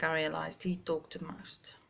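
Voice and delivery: unvoiced hushed realization; whispered aside. Real, not acted. Looking back on the date, whispered aside. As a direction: confide it in a whisper.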